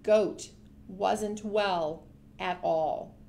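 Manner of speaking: plain reading voice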